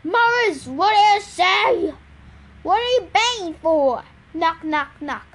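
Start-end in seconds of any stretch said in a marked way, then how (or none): 0.0-1.9 loud, high, slow shout; shouting back. A narrator's reading. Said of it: "shouted back". 2.6-4.0 loud, higher-pitched yelled question; yelling. A narrator's reading. Truckers yelling to each other.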